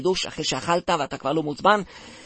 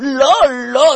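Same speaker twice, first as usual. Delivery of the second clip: urgent no; 9 semitones higher, 9 dB louder, much slower than usual. Acted. Urgently stops him.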